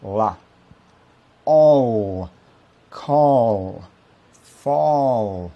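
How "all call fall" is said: All Call Fall loud with a dark back-tongue L; American dark L. Shown as a demonstration. Teacher demonstrates the American dark L loudly. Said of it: "Les L sont plutôt prononcés avec".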